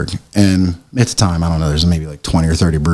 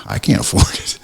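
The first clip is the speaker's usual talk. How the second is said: voiceless near-whisper, retelling his own reply; whispered retold admission; hushed aside. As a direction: let the voice drop out in a mock confession. Retelling his reply about money.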